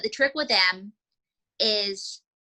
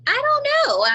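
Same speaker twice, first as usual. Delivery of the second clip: much higher, louder coy puppet voice; squeaky puppet girl; 6 dB louder than usual. Acted. Puppet April answering alligator's swamp invitation, coy and high. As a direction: play a coy female puppet.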